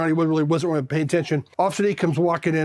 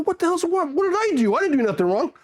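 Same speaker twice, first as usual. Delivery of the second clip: high, mock-alarmed pleading; imitating a frantic recruit; retold panicked protest; 11 semitones higher than usual. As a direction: protest your innocence, pitched way up. Scared recruit protests being sent back.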